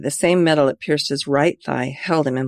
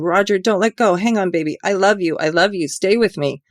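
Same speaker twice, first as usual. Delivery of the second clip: higher, louder desperate begging; screaming pleas; 5 semitones higher, 4 dB louder than usual. A narrator's reading. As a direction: beg him to hold on. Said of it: "while she screamed".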